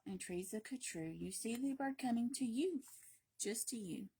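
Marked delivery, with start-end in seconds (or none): none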